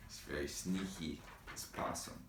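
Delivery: quiet, secretive aside; soft confiding. Acted. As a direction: share a secret softly. Reader confides to kids.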